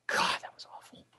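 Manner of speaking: breathy whispered groan of disgust; whispered aside. Real, not acted. Aside after hearing the show.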